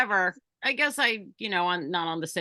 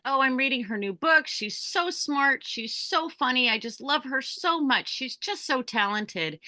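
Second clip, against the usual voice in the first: gushing fan; 6 semitones higher than usual. Retold or imitated. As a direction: pour out admiration like a smitten fan. Gushing to [name] about [name].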